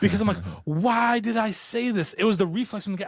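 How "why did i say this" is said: high, drawn-out panicked self-reproach; mock-anguished regret at your own words; freaking out. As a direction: retell your own panic, pitched up and stretched. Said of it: "I was freaking out".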